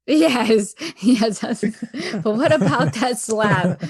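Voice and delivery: usual speaking voice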